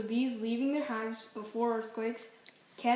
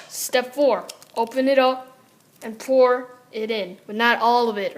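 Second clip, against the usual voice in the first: ad read; 7 dB louder than usual. Acted.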